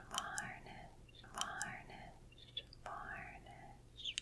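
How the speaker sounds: soft drawn-out whispered repeats; repeated trigger word. Acted. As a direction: whisper each word slowly and softly. Trigger words repeated softly.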